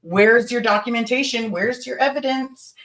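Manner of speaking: high-pitched, demanding re-enacted grilling; nasty interrogation. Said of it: "Is that how you talked?".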